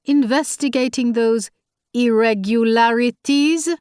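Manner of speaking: irregularities stretched and pressed sceptically; sarcastic stress on irregularities. A narrator's reading. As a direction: lean on irregularities to mock it. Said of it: "He put just enough emphasis on the word".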